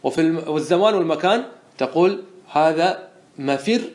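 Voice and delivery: ordinary talk, nothing marked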